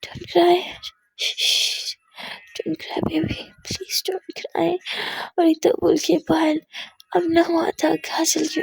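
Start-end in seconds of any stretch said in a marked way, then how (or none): 0.0-4.8 hushed, slow and much softer; tearful soothing whisper. Acted. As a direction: whisper comfort through her own tears. Comforting crying girlfriend, whispers.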